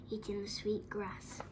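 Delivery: usual speaking voice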